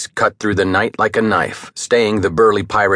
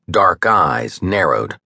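plain reading voice

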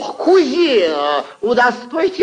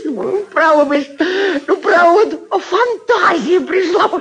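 excited; 7 semitones higher than usual. Acted. Whims aloud, voice rising.

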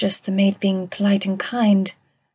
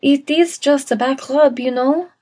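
put-on higher-pitched, uneasy French-accented protest; mildly alarmed; 6 semitones higher than usual. A narrator's reading. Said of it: "sounding alarmed".

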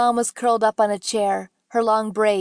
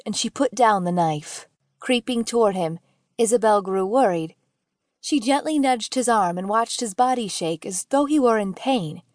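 ordinary delivery

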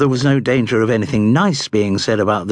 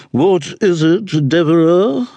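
high, imperious matron voice; haughty dowager duchess; 8 semitones higher, 3 dB louder than usual. A narrator's reading. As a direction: ask like a disdainful grande dame.

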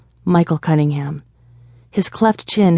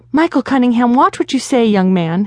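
high, louder sharp motherly scold; 7 semitones higher, 3 dB louder than usual. A narrator's reading. Mother scolding her grown son Michael, high and loud. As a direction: tell him off like a mother reining in a son. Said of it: "his mother scolded".

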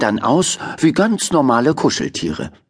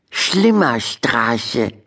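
slow halting reading; much slower than usual. Acted. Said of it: "las Henrietta vor".

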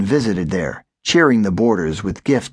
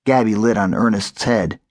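plain reading voice

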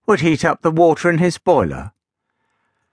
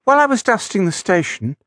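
tiny woman voice; 7 semitones higher, faster than usual. Acted. Minnie Millie starts her tale.

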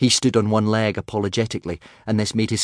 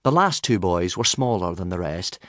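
ordinary delivery

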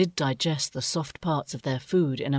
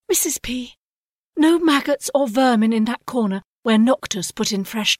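higher voice, a fussing mother bird; anxious mother owl; 8 semitones higher, 5 dB louder than usual. A narrator's reading. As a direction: fuss over the nest, a bit louder.

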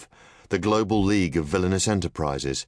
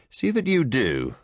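higher and slow, cool dismissal; curt command; 7 semitones higher than usual. A narrator's reading. Nero dismisses her.

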